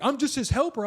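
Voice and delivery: high, sheepish helper voice; helper excuse. Retold or imitated. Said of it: "he was like".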